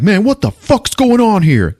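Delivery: high, loud and drawn-out shocked yell; shocked outburst. Retold or imitated. Lonnie shouting at the stranger in his kitchen, shocked. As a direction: blurt it out, stunned and rattled.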